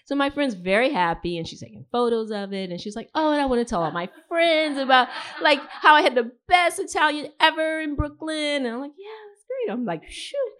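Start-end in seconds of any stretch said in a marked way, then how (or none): 3.2-8.7 loud, excited gushing; imitated gushing friend. Visiting friend raves about Italian food, imitated gushing. Voicing delighted friend in story. 9.0-9.7 high, softer feigned agreement; retold uneasy agreement. Host going along with friend's mistake, uneasy agreement. 10.1-10.5 high little aside of dismay; retold dismay. Host realizes restaurant isn't in Brooklyn, small dismay. Voicing private dismay in story.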